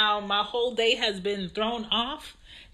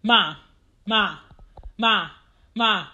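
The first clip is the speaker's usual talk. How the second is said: put-on child calling out mom over and over; a kid's repeated calling, not quite a shout; 4 dB louder, slower than usual. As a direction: nag like a little kid tugging for attention. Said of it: "Anya saying".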